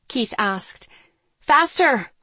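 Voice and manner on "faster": high, loud urgent yell; raised, urgent command; shouted. A narrator's reading. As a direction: shout to hurry them along. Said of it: "I shouted".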